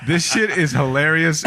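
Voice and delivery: high, laughing through the words; can barely hold back the laughter. Retold or imitated. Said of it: "I was like".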